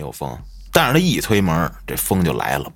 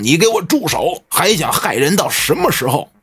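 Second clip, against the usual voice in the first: high, sharp order to stop; commanding shout; 8 semitones higher than usual. Retold or imitated. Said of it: "大师见状就大喊一声". (女大师高声喝止)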